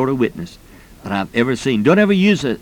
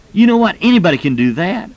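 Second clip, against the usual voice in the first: high, louder retold enthusiasm; excited realization; 7 semitones higher, 4 dB louder than usual. Retired executive coming to the teacher after class, excited. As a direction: burst out with it, pitched well up.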